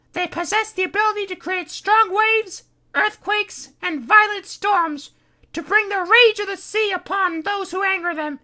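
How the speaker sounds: Kitty's voice. Acted. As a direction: warn them darkly. Kitty explains legend.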